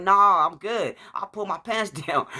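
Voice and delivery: high, insistent re-enacted protest; mock-pleading objection to being cut; pleading refusal. Retold pleading with ambulance lady.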